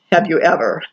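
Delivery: usual speaking voice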